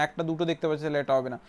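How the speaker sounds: ordinary talk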